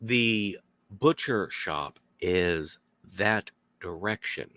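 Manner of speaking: slow, careful directions; slow and clear. Retold or imitated. Giving a foreigner directions, slow and careful. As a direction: speak slowly and plainly for a learner. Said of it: "slower or slowly and correctly".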